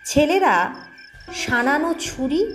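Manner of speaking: ordinary delivery, nothing marked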